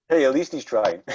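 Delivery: chuckling through the words; laughing. Real, not acted. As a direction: laugh while saying it. Laughing at bad accent joke.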